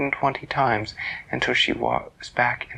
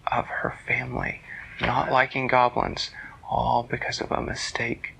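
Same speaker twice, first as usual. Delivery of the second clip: slow, whispered storytelling; hushed wonder narration; 3 dB quieter than usual. A narrator's reading. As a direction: whisper the happy ending in awe.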